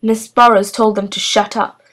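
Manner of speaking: ordinary delivery, nothing marked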